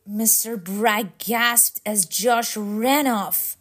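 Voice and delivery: plain reading voice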